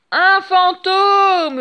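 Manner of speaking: loud, high, slow scream of panic; the pirate sees a ghost and howls in terror; terrified yell. A narrator's reading. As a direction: howl in fright at a ghost. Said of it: "Hurle Monsieur Mouche affolé".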